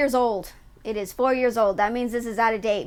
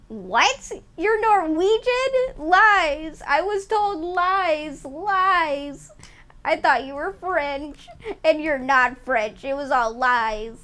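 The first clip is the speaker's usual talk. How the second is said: drawn-out, hammed-up wail; mock betrayal; 4 semitones higher, slower than usual. Streamer lamenting a chatter's lies about being French, mock betrayal. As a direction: stretch it out like a melodramatic victim.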